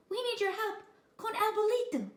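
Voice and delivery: high, loud pleading little dog. A narrator's reading. Chihuahua asking kitten for help, high pleading. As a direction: beg for help.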